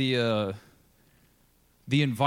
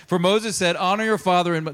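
scripture reading; 5 semitones higher, 7 dB louder than usual. Acted. Reads scripture aloud.

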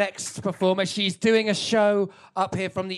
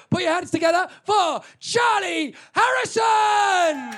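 loud, high, slow announcer call; booming compere intro; host announcement; 11 semitones higher, 8 dB louder than usual. Real, not acted. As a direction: bring the next act on with a big call. Host introducing next act.

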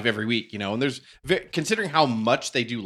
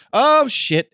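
high, loud mock alarm; retold yelp of realizing a blunder; caught-out dismay; 11 semitones higher, 7 dB louder than usual. Beefcake caught on live TV too soon, mock alarm. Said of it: "He's like".

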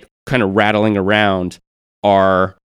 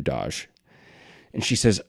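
normal talking voice

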